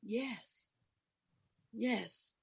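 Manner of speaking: very soft, faint yes yes; hushed yes. Retold or imitated. Kneeling in the foyer, answering God with a hushed yes. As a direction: barely breathe the answer. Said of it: "And I said".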